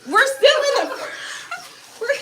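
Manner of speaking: high, loud, laughing through the words; cracking up. Real, not acted. Crafter caught out by husband, cracking up. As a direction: dissolve into helpless laughter mid-sentence. Breaks up laughing mid sentence.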